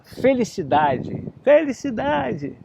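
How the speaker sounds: grito lento e agudo. Acted. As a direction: chame a palavra devagar, alto. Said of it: "Agora grita". (menino grita felicidade para a floresta, devagar e agudo)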